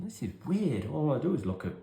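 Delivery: high puzzled inner voice; baffled and a bit defensive; puzzled kid. Retold or imitated. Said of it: "I remember thinking".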